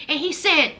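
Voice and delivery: ordinary delivery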